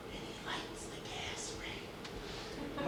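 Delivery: slow, hushed whisper. Acted. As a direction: breathe it out spooky. Spooky story whisper.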